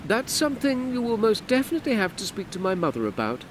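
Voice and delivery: brisk dismissive woman; Hortense, curt. Acted. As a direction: end the conversation. Hortense final rebuff.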